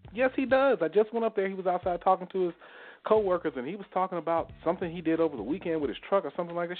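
high-pitched retold protest; imitated insistent back-and-forth; retold insistence. Retold argument with mother.

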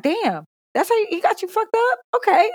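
high-pitched, incredulous retort; exaggerated high disbelief; mock shocked reaction. Mother acts out reaction to rant.

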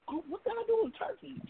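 high, wide-eyed musing; mock wonder. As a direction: act amazed at the thought. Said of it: "and I'm like".

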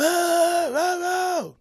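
high, loud run of yowls; heckler noise. Retold or imitated. Heckler cutting into the introduction, loud wordless yowling. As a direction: yowl nonsense over the host. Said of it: "this is the kind of sounds he's making".

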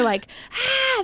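retold car meltdown scream; mock scream. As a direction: squeal a brief pretend scream.

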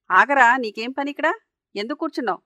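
narrated scolding, loud on the opening; a storyteller's mildly angry browbeating; browbeating challenge. A narrator's reading. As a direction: challenge the boy with an accusing burst. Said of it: "అని వాడిని దబాయించేసరికి".

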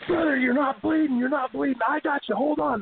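high, pressing in-ring aside; acted urgent alert. Wrestler to opponent who isn't bleeding, high urgent mock alert. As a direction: urge him in a high, sustained voice. Acts himself urging opponent mid-match.

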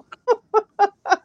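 bright burst of laughter; laughing hard; high laughter. Real, not acted. Laughs after joke.

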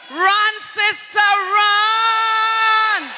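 high, drawn-out shout of alarm; urgent shouted warning. Acted. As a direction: stretch the cry out long and loud. Urging women to flee danger.

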